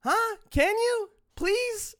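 loud, squeaky mock begging; mock high pleading. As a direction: plead way up in pitch, mockingly.

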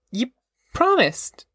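put-on very high, indignant girl's voice; hurt protest. A narrator's reading. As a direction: protest high, accusing him. Girl protests broken promise.

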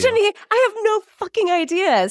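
exasperated high-pitched. Real, not acted. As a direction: voice jumps way up in disbelief. Exasperated answer to co-host.

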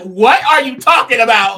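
slow, loud, incredulous; loud, slow mock disbelief; mock indignant. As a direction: drag it out like she can't believe it. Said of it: "And it's like".

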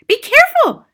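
very high, loud shriek; mother shrieking. A narrator's reading. Mother sees powder spilled on jacket, shrieking. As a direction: shriek the warning in alarm. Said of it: "shrieked the mother".

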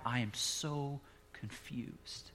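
slow, soft, lost-sounding quote; a slow, soft, bewildered man; confused man. Retold or imitated. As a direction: let the confusion drag out quietly. Confused ex-prisoner voiced.

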